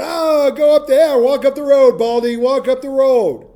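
high, loud, slow sing-song jeering; mocking youths shouting at the prophet; bratty teen taunting. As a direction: drag out the taunt like a bratty preteen. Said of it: "Preteens or teenagers going".

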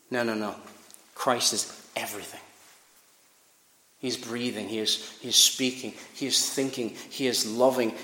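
low, soft, grave preaching; hushed intensity. Acted. Preacher warns friends of life without Jesus, low and grave. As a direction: lean in and warn softly.

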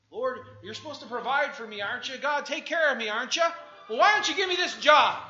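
loud, high, entitled questions; demanding prayer. Retold or imitated. Job seeker demanding God provide, loud and pushy. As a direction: push God like he owes you.

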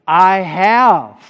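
"I have" loud and forceful; emphatic answer. Shown as a demonstration. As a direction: drive home "I have". Answers his own question emphatically.

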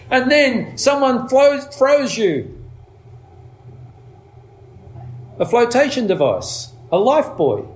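high, ringing preacher's cry; full-force preaching. Acted. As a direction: lift the congregation to grab the life buoy.

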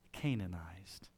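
Canaanized breathed out in a grave whisper; hushed and grave. Real, not acted. Grave sermon climax.

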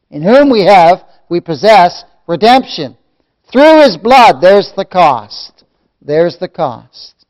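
loud, emphatic scripture proclamation; forceful preaching. Real, not acted. Preacher declaring the text's price of redemption, forceful preaching. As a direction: punch each phrase of the verse, then ease down.

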